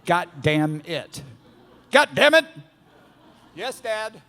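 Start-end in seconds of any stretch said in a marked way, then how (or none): 1.9-2.4 high, loud playful mock yell; angry dad. Imitating dad yelling for his kid, angry. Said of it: "that's the angry part of my dad". 3.6-4.2 put-on small, high kid voice; meek child. Kid answering an angry dad, meek.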